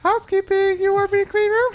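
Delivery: David Spade as maid. Retold or imitated. Spade pestering Farley in a high falsetto. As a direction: chirp the offer sweetly through the door. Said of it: "he's like".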